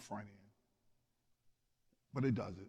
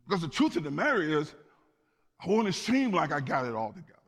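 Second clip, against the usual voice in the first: louder, higher, driving confession; impassioned preaching; 5 semitones higher, 6 dB louder, faster than usual. Real, not acted. Preacher admitting he wants to seem together, impassioned preaching. Preaches on wanting to look sorted.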